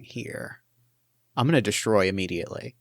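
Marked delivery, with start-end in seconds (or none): none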